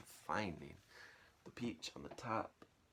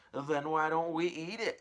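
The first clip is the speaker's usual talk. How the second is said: higher, louder eager aunt; greedy aunt; 9 semitones higher, 9 dB louder than usual. Acted. Aunt suggesting they eat the peach, loud. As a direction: say it hungrily.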